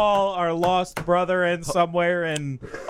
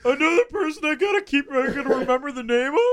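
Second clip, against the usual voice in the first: whiny; 9 semitones higher than usual. Retold or imitated. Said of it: "so fucking whiny about it".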